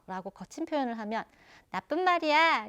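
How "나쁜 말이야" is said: scolding. Retold or imitated. Mother scolding rude words.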